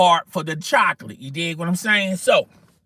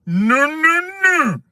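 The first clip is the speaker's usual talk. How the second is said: high, loud sing-song no no no; mock refusal; 13 semitones higher, 5 dB louder, slower than usual. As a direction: wag a finger and squeal the refusal. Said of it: "I'm wagging my finger going".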